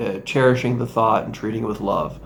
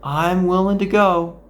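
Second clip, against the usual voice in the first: lightly voiced, higher and breezy, letting it all go; relaxed shrug of a quote; easygoing acceptance; 6 semitones higher than usual. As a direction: say it like you truly don't mind. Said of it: "just allowing".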